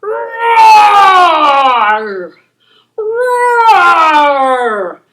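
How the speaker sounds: loud playful T-Rex roar; dinosaur roar. Shown as a demonstration. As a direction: roar it out big for the kids. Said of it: "roar like a giant dinosaur".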